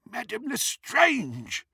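very high, drawn-out character voice; startled goblin. A narrator's reading. Old goblin recognising a feared customer, startled. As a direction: stretch the name high in alarm. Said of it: "evidently startled".

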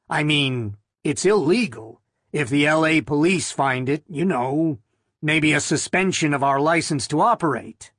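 ordinary delivery

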